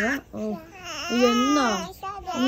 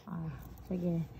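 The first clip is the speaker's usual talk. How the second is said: normal talking voice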